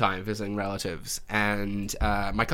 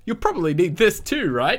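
high, louder salesman pitch; sly upsell; 7 semitones higher, 5 dB louder than usual. Retold or imitated. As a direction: slip in the upsell slyly. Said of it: "And I just kinda went".

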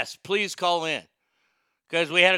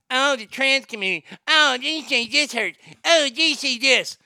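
higher, fast mocking whine; 6 semitones higher than usual. Streamer mocks offended critics in a whiny voice. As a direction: sneer at the offended crowd. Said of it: "mocking critics".

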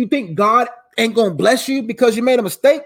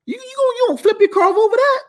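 much higher, put-on voice of God scolding; God's voice; 13 semitones higher, faster than usual. The preacher voicing God, who teases him about flipping his car, much higher. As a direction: play God gently mocking him over it. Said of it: "And God was like".

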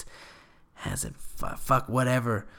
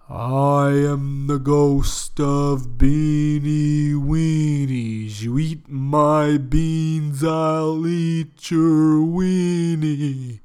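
loud, slow, drawn-out ghostly voice; a spirit intoning from the can, slow and loud; booming ghost; 5 semitones higher, 10 dB louder, much slower than usual. Acted. Ghost speaking from the beans.

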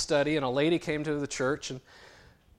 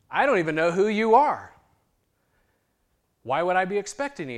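higher, louder, quicker puzzled question; baffled, asking why you'd be expected; mock puzzled; 6 semitones higher, 10 dB louder than usual. Pastor puzzled by the dying man, mildly surprised.

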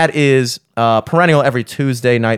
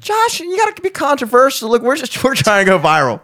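high mocking voice; 11 semitones higher than usual. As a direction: pitch way up, teasing.

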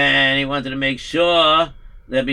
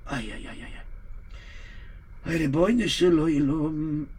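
soft mournful sighing; 7 dB quieter than usual. Acted. Rabbi sighing softly to God.

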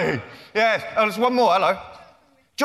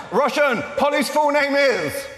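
calling out; 7 semitones higher, slower than usual. Real, not acted. Cueing the kid with a call.

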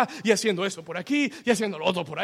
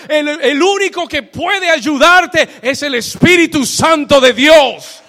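gritado, fuerte y enfático; predicación a todo pulmón; 9 semitones higher, 13 dB louder than usual. Acted. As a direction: predica gritando con convicción. Preaching at full force. (predicador gritando a la congregación)

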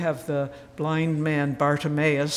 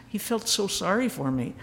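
normal talking voice

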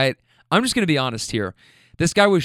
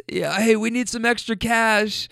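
higher, lightly voiced wife; joking wife; 7 semitones higher than usual. Wife joking about robbing the store, higher imitated voice. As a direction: play her mock suggestion.

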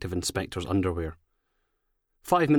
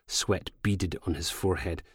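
ordinary delivery, nothing marked

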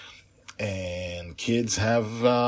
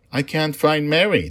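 put-on high, loud little child's voice; a small lost child speaking up; little dragon; 10 semitones higher, 11 dB louder, faster than usual. Little dragon answers the general.

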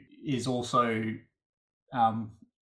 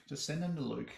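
"Just send them to Luke" said softer, tossed off as an afterthought; quiet aside; 8 dB quieter than usual. Acted. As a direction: drop it under your breath. Quiet repeat of the joke.

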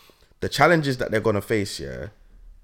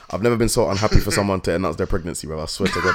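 usual speaking voice

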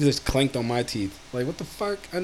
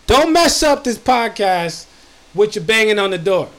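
raised, slowed call toward the door; 6 semitones higher than usual. Real, not acted. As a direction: call out to whoever is banging. Yelling at noise at door.

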